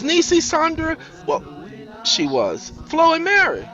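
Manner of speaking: high, loud name list; lightly high listing. Acted. Sammy lists the women for Keith. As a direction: rattle off names.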